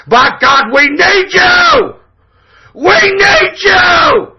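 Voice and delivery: high, drawn-out screaming plea; impassioned shout. Real, not acted. Radio host begging right-wing listeners to join, impassioned shout. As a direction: holler the rallying cry, stretching the words. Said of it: "hear the fucking passion that's in this fucking voice".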